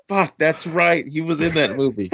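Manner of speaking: mock startled, remembering the movie; lightly played 'oh of course' moment; sudden realization. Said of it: "I was like".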